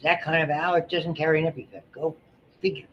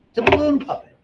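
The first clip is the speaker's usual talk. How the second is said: much higher, louder squeak; squeaky high voice; 14 semitones higher, 6 dB louder than usual. Pretend. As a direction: squeak it like a toy. Squeaky voice for balloon puppet.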